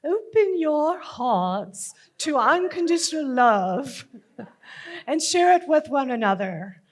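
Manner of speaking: put-on healing teacher voice, lilting over the room; hammed-up spiritual teacher; teacher calling out. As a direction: call a group to open up. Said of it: "My healing school teacher is calling out".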